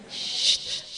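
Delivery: ordinary delivery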